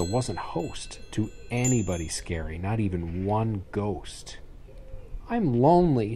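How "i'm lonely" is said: higher, louder lonely cry; a child admitting she's lonely; lonely lament. A narrator's reading. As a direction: wail it out, lonely. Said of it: "she admitted".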